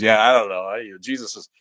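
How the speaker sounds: ordinary talk, nothing marked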